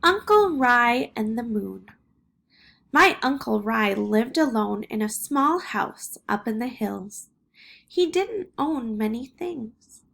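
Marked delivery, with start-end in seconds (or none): none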